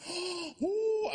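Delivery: sharp high gasp of fright. Retold or imitated. Reliving the plane drop, a high gasp. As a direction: suck in air as the plane drops.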